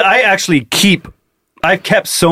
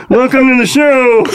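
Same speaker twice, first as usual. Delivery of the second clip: high, pinched frog-puppet voice; Kermit impression; 7 semitones higher than usual. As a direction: greet the crowd like a Muppet host. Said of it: "How's that Kermit impression?".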